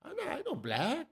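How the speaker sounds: very high, soft airy voice. Retold or imitated. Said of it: "his weird, eh, fucking [name] accent".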